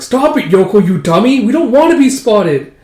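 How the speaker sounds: sharp loud hushed-urgent telling off; scolding. Acted. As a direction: snap at her sister to stop.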